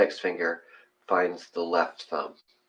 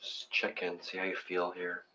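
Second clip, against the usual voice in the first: guided meditation; 7 dB quieter than usual. Acted. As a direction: talk them gently into their breath. Leads breathing meditation.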